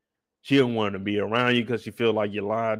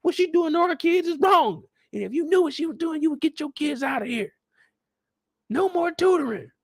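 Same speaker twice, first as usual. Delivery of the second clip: much higher mock mom voice, yelling; shrill angry mom. Outraged mom shouting down the staff, higher mock fury. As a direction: squeal it like an outraged mother. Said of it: "angry she was with Candy".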